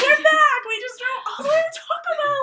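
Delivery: high, loud and excited; excited announcement. Real, not acted. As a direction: burst in with big energy. Hyped return to camera.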